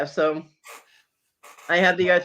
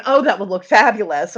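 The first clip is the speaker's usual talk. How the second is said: lightly voiced gushing designer, louder; Nate Berkus; 2 semitones higher, 7 dB louder than usual. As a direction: gush a little like a showy designer. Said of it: "the whole Nate Berkus impersonation".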